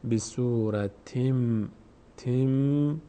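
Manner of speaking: بصورتیم ending on a long held m; n read as m. Shown as a demonstration. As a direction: slowly close the tanween of بصورتیم into m.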